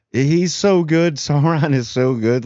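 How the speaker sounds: high, delighted praise with a laugh; excited gushing. Real, not acted. Fan gushing to viewers about the actor, excited and high. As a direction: gush over how good he is.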